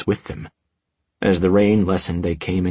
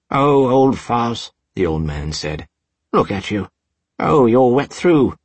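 put-on old man voice; 4 semitones higher, 5 dB louder than usual. A narrator's reading. Old man tenderly greets cat.

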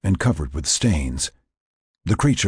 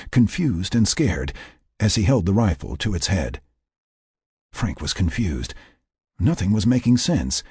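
plain reading voice

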